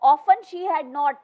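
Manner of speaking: ordinary delivery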